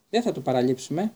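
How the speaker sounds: usual speaking voice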